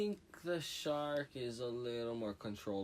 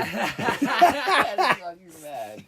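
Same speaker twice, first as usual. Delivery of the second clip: slow laugh-filled outburst; cracking up mid-sentence; 8 semitones higher, 13 dB louder, much slower than usual. Real, not acted. Laughing at van photo.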